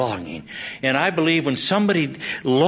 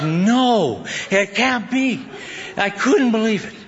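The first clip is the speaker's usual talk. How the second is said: higher, stretched cry of disbelief; 6 semitones higher, slower than usual. Retold or imitated. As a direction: re-enact refusing to believe bad news. Preacher re-enacts his disbelief at bad news.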